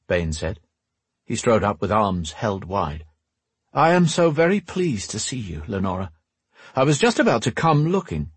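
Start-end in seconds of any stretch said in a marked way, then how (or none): 3.7-8.3 lightly voiced courteous rogue, higher pitch; effusive greeting in a slightly put-on rogue voice; suave rogue. Voices Bane in the story.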